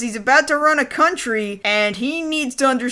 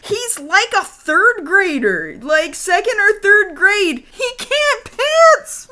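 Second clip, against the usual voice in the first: high, incredulous comic protest climbing higher; hammed-up disbelief, pitch soaring at the end; mock outrage; 7 semitones higher, slower than usual. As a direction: build to a squeaky, drawn-out exasperation.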